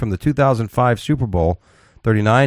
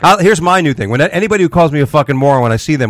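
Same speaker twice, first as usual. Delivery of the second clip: loud tough talk; 6 semitones higher, 4 dB louder than usual. Real, not acted.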